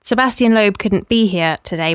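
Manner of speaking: ordinary delivery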